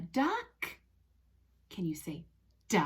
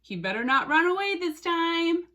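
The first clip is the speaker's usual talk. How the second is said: high, quick mock warning; mock-fret about a runaway toy; playful warning; 7 semitones higher, 4 dB louder, faster than usual. Teacher wary of her toy goat escaping, high playful warning.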